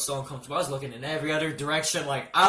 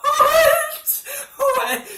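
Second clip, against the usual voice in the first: much higher, louder shocked yell; shocked shout; 9 dB louder than usual. Retold or imitated. Yelling at the doctor in laughing disbelief.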